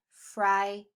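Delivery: FRIGHTEN split slowly, weight on FRIGH; accented first syllable. Shown as a demonstration. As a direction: stress FRIGH in FRIGHTEN, pause, then ten. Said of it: "syllable being accented".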